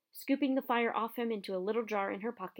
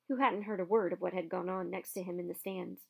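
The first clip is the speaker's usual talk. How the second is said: ordinary delivery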